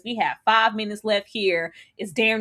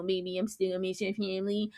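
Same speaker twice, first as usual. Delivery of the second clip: put-on much softer pleading baby; mock soft promise; 2 semitones lower, 10 dB quieter than usual. Man promising to meet the family, mocked. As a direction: coax her quietly.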